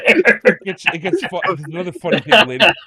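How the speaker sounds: high, loud, through laughter; real giggling fit breaking into speech; laughing while talking. Real, not acted. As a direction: push the words out while cracking up. Cracking up over the joke.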